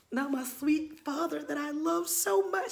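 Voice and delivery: high, drawn-out whimper; mock lament. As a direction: whimper tenderly, overdoing the grief. Lamenting letting her father down.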